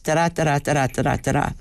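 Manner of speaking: steady even "da-da" beats, second beat stressed; iambic rhythm. Shown as a demonstration. Guest repeats the teacher's iambic beat, even and regular. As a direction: tap out the iambic "da-da" pulse evenly. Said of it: "to be like this".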